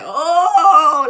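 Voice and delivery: high, loud startled gasp of an oh; jolted as the truck lifts. Retold or imitated.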